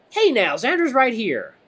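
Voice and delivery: high, loud, drawn-out objection; playful protest. A narrator's reading. Redline answering friend's teasing about shortness, playfully protesting. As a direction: push back on the joke playfully.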